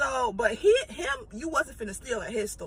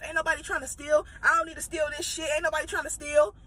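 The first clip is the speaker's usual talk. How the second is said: higher, fast indignant shoplifter voice; mock angry customer; 4 semitones higher, faster than usual. Retelling the shoplifter snapping at the manager, higher indignant mock anger. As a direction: fire it back like you're offended to be watched. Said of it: "the girl is going off on him".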